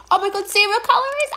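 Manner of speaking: high, fast delighted exclamation; excited. Real, not acted. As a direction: gush in shocked delight. Amazed by the pickle label.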